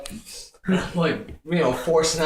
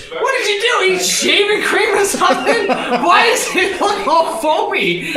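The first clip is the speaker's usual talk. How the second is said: wife's voice; 12 semitones higher, 4 dB louder than usual. Retold or imitated. Wife inspecting husband's backside, high and laughing. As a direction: squeal it through laughter like the wife. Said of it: "she goes".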